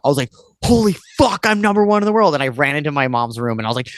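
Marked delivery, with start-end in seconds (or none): 0.6-2.3 retold freak-out. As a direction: blurt it out like you just saw your name top the world. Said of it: "and I flipped out".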